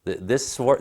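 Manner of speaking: usual speaking voice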